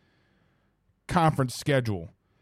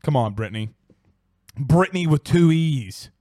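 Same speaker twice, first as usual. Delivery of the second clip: usual speaking voice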